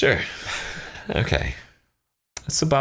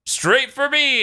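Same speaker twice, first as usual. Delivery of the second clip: higher, excited mock Pilaf voice; 8 semitones higher than usual. Host acts out Pilaf freaking out, excited mock voice. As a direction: freak out over the radar. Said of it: "He's like".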